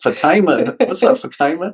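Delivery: high-pitched Fatima as fa-TIE-ma; playful mispronunciation. Pretend. Joking with cohost about the name, Fatima as fa-TIE-ma. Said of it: "It's not Aunt Jemima".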